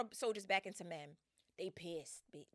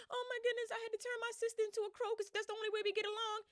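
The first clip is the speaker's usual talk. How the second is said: much higher, whiny mock sob; mock crying; 14 semitones higher, faster than usual. Circe fake-crying to Odysseus, high and whiny. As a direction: whimper it out like a put-on cry. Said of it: "Circe get to crying".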